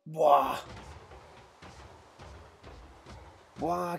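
explosive exhale of relief. Real, not acted. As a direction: let out a big blown-out buah. After death, tense fight.

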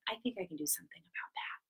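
secretive whisper. Acted. Teacher plotting a new wig for the kids, whispered.

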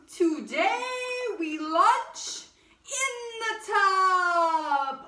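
loud, high, slow royal proclamation; booming king. A narrator's reading. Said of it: "cried the king".

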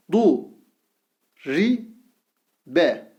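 Gu said with a thick back U, each syllable drawn out; syllable drill with thick vowel. Shown as a demonstration. Teacher reads the thick-vowel syllables to students, slowly. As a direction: read out each syllable apart. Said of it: "U sesi veriyoruz".

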